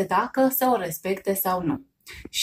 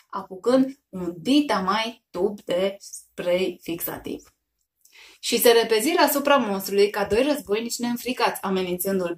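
ordinary delivery

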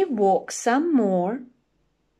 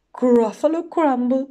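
loud, startled repeat; alarmed outburst; 4 semitones higher, 8 dB louder than usual. Acted. Gruffalo hears he is to be eaten, loud alarm. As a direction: blurt it out in alarm.

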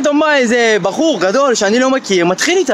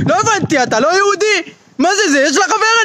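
higher, indignant challenge; mock tough confrontation; 8 semitones higher than usual. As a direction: square up to him, offended. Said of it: "אני בא לשם עצבני". (עימות עצבני בקול גבוה)

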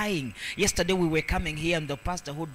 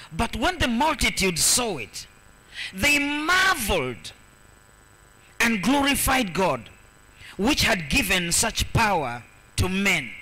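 raised, emphatic reading with a long, high key word; proclaimed Bible verse; 4 semitones higher, 4 dB louder than usual. Acted. Preacher reading verse eight to the congregation, proclaimed. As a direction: proclaim the verse, stretching the marvel.

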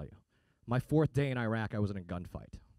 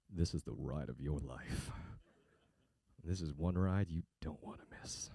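much lower, softer, slow creepy voice; creepy ad read; 11 semitones lower, 9 dB quieter than usual. Shown as a demonstration. Voiceover guy trolling a car ad with a creepy read. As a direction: read it like a creep whispering in your ear. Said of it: "This is how I read it".

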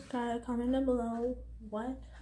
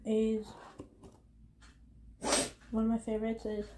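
eating between words; talking while chewing food. Real, not acted. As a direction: mouth partly full. Seen 0: Fork to mouth at 0-1.7, box at mouth later; no taco seen.

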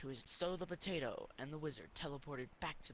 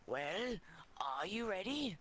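put-on old wizard voice; 7 semitones higher, 4 dB louder, slower than usual. Wizard presses potato.

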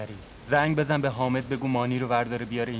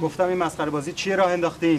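louder, demanding boss; angry raised voice; 5 semitones higher, 4 dB louder than usual. Acted. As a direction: raise your voice at him. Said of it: "داد نزن".

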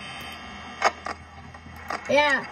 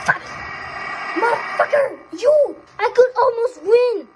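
high, loud angry yelling; real gamer rage, shouted; furious shout; 8 semitones higher, 4 dB louder than usual. Real, not acted. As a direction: rage at losing the game. Killed just before winning.

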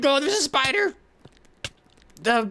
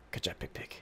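whisper; 17 dB quieter than usual. Real, not acted. Praising pig after escape.

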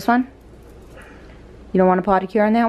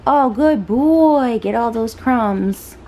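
doggy praise voice; 5 semitones higher, 5 dB louder, much slower than usual. Real, not acted. Owner praising her dog for eating, high slow pet talk.

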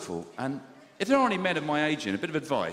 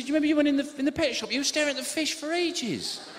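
wife voice; 11 semitones higher than usual. Retold or imitated.

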